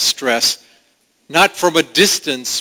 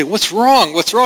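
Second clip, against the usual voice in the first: higher, anxious mother asking; mother pleading with her child, higher; mom voice; 7 semitones higher than usual. Retold or imitated. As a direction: play the worried mom coaxing her toddler. Mom asking crying toddler.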